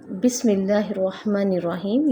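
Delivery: melodic tajweed recitation; Quranic recitation. Shown as a demonstration. Teacher recites the Basmala for students, melodic. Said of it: "यहाँ पर हम बिस्मिल्लाह".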